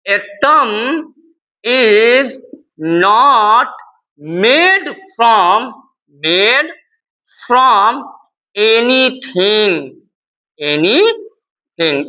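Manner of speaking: one word at a time, long gaps; slow dictation. Real, not acted. Teacher telling students what to write, slow dictation. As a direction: stretch each word out for writing. क्या लिखेंगे.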